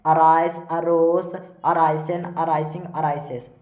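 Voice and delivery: slow, evenly spaced word-by-word chant; verb drill recitation. Shown as a demonstration. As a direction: recite each form separately for pupils to memorise. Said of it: "इसको इस प्रकार से पढ़ेंगे".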